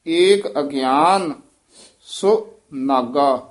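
slow, ਸੋ and ਨਾਗਾ as two separate words; ਸੋ ਨਾਗਾ separated. Shown as a demonstration. Teacher demonstrating to student, slow split ਸੋ ਨਾਗਾ. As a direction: split ਸੋ ਨਾਗਾ apart slowly. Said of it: "ਸੋ ਨਾਗਾ ਇਉਂ ਉਚਾਰਨ ਕਰਨਾ".

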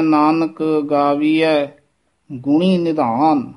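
slow, resting after ਗਾਵੀਐ; pause after ਗਾਵੀਐ. Shown as a demonstration. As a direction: set ਗਾਵੀਐ apart with a pause. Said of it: "ਗਾਵੀਐ ਪਦ ਤੇ ਵਿਸਰਾਮ ਲਾਉਣਾ ਹੈ".

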